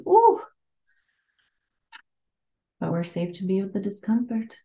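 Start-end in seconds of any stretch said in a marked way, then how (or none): 0.1-0.4 high relieved ooh from a neck stretch; stretching groan. Real, not acted. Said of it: "I'm just stretching 'cause my neck".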